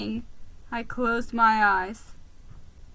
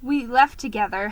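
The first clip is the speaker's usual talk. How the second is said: plain reading voice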